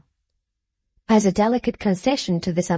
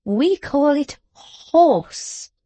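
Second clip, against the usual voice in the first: very slow, higher, patient host; forbearing correction; 7 semitones higher, 3 dB louder, much slower than usual. A narrator's reading. Host correcting a foreigner, with forbearance. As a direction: correct him with strained patience. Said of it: "with forbearance".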